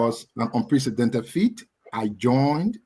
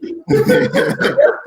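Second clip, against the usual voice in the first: high-pitched cackling laugh; shrieking laugh; high burst of laughter; 7 dB louder than usual. Real, not acted. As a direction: let out a loud high laugh. Laughs at juju joke.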